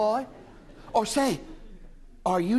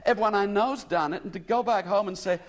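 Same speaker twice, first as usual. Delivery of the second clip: higher, lost American woman near tears; tearful; 7 semitones higher than usual. A narrator's reading. American lady telling William she is lost, tearful. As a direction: plead on the edge of a sob. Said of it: "she ended in a sob".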